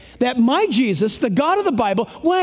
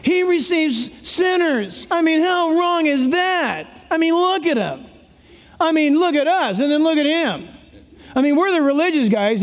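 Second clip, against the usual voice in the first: whining; 5 semitones higher than usual. Acted. Pharisees complaining to each other about Jesus receiving sinners, whining. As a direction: whine it out in scandalized disbelief. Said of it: "in their whining, they're like this".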